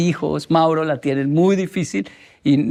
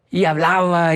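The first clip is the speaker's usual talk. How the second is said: normal talking voice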